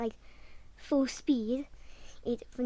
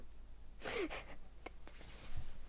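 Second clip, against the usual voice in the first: breathy laugh; 8 semitones higher, 11 dB quieter than usual. Real, not acted. Laughing at own story.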